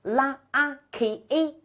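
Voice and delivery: "拉，啊，K，A" spelled out sound by sound; sounding out syllables. Shown as a demonstration. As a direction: break "lucky" into "拉" and "K". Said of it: "两个音节".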